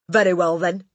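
loud, curt concession; Trunchbull. A narrator's reading. Headmistress grudgingly answering the boy, loud voice. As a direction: concede it loudly.

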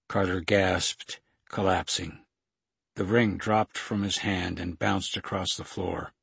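ordinary delivery, nothing marked

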